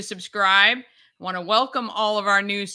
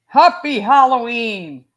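loud greeting muffled by a plastic mask; muffled through a mask; 2 semitones higher, 8 dB louder than usual. Real, not acted. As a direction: shout it through a stuffy Halloween mask. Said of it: "I can't breathe in this thing".

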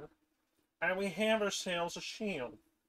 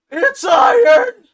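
shrieking with joy; excited scream; 21 dB louder, slower than usual. Real, not acted. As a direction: scream it in delight. Finding iron in game, screams.

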